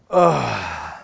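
guttural grunt sitting down; old person's groan. Retold or imitated. Preacher imitating old people sitting down, groan. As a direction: imitate an old man settling into a chair. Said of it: "old people, whenever they sit down".

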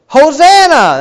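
loud, high exultant cry; crowd shout. Retold or imitated. Preacher voicing the crowd's jubilant shout at Jesus. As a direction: cry it out like a crowd hailing a king. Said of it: "they go".

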